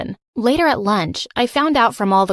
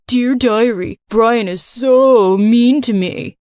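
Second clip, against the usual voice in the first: slow, louder whiny mock of a girl; 5 dB louder, slower than usual. A narrator's reading. Bully reading her diary aloud, slow whiny mockery. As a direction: read it out as a sappy mockery.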